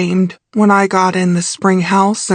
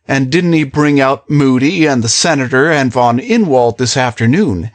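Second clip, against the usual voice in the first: male character voice; 6 semitones lower than usual. A narrator's reading.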